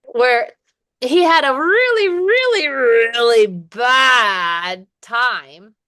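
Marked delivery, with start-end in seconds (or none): 1.0-5.7 REALLY pitched high and repeated, BAD stretched out slowly; drawn-out emphasis. Shown as a demonstration. Teacher recapping a swimmer's story, dramatic drawn-out stress on "really" and "bad". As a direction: hammer each "really", then drag out "bad".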